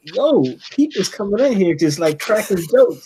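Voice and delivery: worked-up, half-amused protest; indignant reaction. Retold or imitated. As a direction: call out the cheek of it in disbelief. Retelling own reaction to the scene.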